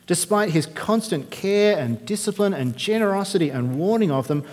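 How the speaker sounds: normal talking voice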